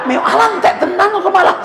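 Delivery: very high meow then dismayed laugh; meow then exasperated. Acted. Wife finding the cat ate her fish, meowing and exasperated. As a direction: squeal like the cat, then despair.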